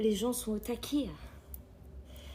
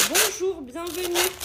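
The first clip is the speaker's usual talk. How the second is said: cheerful greeting; 4 semitones higher, 5 dB louder, slower than usual. Real, not acted. Greeting viewers.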